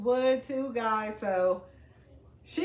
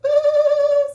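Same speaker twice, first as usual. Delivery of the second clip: delighted ooh; 14 dB louder than usual. Real, not acted. Seeing steel item.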